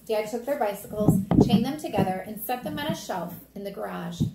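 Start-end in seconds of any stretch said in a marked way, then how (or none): none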